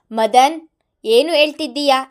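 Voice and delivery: loud furious shout. A narrator's reading. Mithun yelling at Madan on the phone, loud. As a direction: bellow the name. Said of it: "ಎಂದು ಕೂಗಿದವರು".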